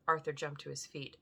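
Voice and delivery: usual speaking voice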